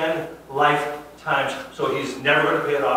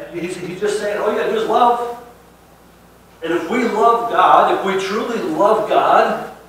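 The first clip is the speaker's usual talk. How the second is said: higher, louder preaching, slowing on love God; preaching at full force; 5 semitones higher, 10 dB louder than usual. Acted. Pastor urging the flock to love as God asks, preaching hard. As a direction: plead the point with fervour.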